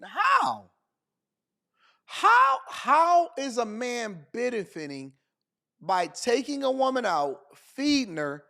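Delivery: high, drawn-out disbelieving "how"; incredulous. Real, not acted. Host challenges her claim about men benefiting, incredulous.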